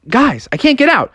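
put-on high, worried kid call; rising alarm. Froggy calls from the locked bathroom, alarmed. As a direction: call for help with growing worry.

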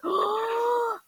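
high gasp and wondering oh; awed toddler. Retold or imitated. Mother voicing her son seeing his sister, awed. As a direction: imitate a little boy's amazed gasp.

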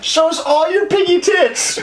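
put-on high, squeaky old-man heckle; Muppet heckler voice. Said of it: "They're like".

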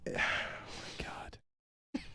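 slow, breath-only sigh of words; real hushed weariness; exasperated whisper. Real, not acted. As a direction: whisper it on a long exhale. Hushed exasperation while losing his thread.

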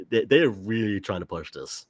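ordinary talk, nothing marked

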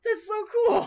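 delighted squeal at the cool lore; thrilled. Real, not acted. Thrilled reaction to napalm blood.

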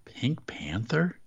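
slow, whispered Pink Panther question; whispered puzzlement. Real, not acted. Cohost questioning an outdated reference, slow whispered disbelief. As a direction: whisper it slowly, baffled by the reference.

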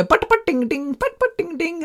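high-pitched singsong mock Indian gibberish; mock Indian accent.

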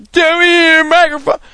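high-pitched mock complaint; Twitter commenter voice. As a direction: squeak it like a fussy online commenter. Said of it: "That's my Twitter voice".

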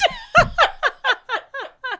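high, helpless laughter; long, high burst of laughing; big laugh. Real, not acted. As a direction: crack up at your own punchline. Laughs at own punchline.

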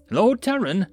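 high-pitched call to a lord; crying out. A narrator's reading. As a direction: cry out from the edge of a crowd. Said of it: "a voice cried out from the edge".

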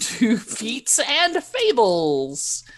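slow, high, loud show intro; big drawn-out welcome back to the show; announcer welcome. Pretend. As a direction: project it like an announcer. Game master's announcer intro.

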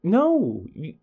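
high-pitched exasperated refusal; retold frustration. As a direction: reenact a flustered teacher saying no. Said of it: "it was so frustrating".

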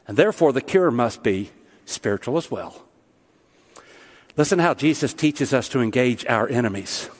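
ordinary talk, nothing marked

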